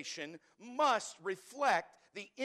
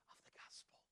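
whispered; 26 dB quieter, faster than usual. Acted. Stating the one assignment.

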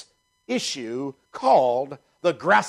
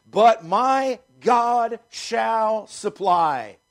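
"But my God shall supply" drawn out slow, every word weighted; emphasis on three words; 3 semitones higher, much slower than usual. Shown as a demonstration. Pastor to the church, slow stressed scripture reading. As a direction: stretch "God shall supply" out slowly, leaning on each word. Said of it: "I emphasize those three words".

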